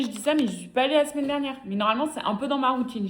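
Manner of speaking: ordinary talk, nothing marked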